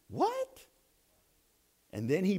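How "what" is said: very high, soft squeak; high-pitched mock bewilderment; baffled. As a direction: squeak it in disbelief. Crew baffled by Patrick's promise.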